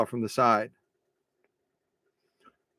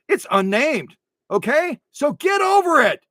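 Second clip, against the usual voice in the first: high, loud comic exasperation; hammed-up shouted retort; mock defiant outburst; 9 semitones higher, 5 dB louder than usual. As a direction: shout back in playful indignation. Mock quarrel with offended Swedish viewers.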